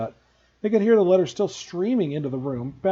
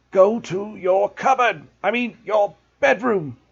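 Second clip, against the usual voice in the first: slow, breathless wheezy shout, higher and louder; wheezing; 6 semitones higher, 6 dB louder, slower than usual. A narrator's reading. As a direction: wheeze it out after the fight. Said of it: "he wheezed at Harry".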